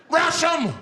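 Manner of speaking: high, loud rallying yell; screamed command. Acted. Passenger rallying the bus, high screamed order. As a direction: scream it to launch a charge. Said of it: "He screamed out".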